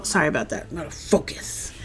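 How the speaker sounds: very soft, voiceless self-reminder; real under-the-breath aside; whispered aside. Real, not acted. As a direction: whisper it to yourself. Aside to self after digressing.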